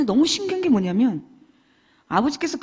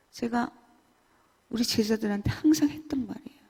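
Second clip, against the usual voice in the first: ordinary delivery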